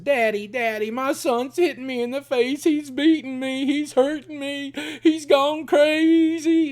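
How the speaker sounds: imitating a mother crying wolf in a frantic high wail; hysterical mother. Mother calling her father to claim her son attacks her, in a frantic high wail.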